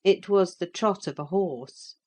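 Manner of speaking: ordinary delivery, nothing marked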